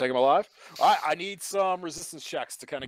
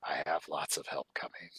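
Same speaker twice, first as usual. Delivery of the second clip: raspy hissing whisper, wheezing; hissing wheezing creature; 3 semitones lower than usual. Acted. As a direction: hiss it like a desperate Gollum. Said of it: "he's, like, hissing and, like, wheezing at you guys".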